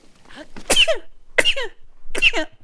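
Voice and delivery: loud, high explosive sneezes; real sneeze fit. Real, not acted. Streamer sneezing fits at the stable, loud bursts. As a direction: sneeze hard, again and again. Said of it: "those were sneezes".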